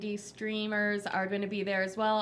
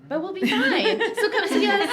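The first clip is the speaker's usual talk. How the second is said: laughing; 9 semitones higher, 9 dB louder than usual. Real, not acted. Laughing over the dice joke.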